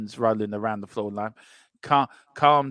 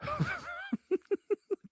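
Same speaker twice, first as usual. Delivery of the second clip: shrieking laugh; 9 dB quieter than usual. Real, not acted. Laughing at the chat's comment.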